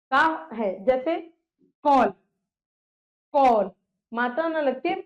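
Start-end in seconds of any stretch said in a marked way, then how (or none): 1.8-3.7 कॉल with an open English "aw"; between aa and o. Shown as a demonstration. Teacher showing a borrowed English vowel, open "aw". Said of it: "इसका उच्चारण आ ओ के बीच".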